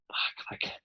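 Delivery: whispered, stunned curse; Alice whispering in amazement; amazed whisper. Retold or imitated. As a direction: breathe it out in disbelief. Alice amazed after the take.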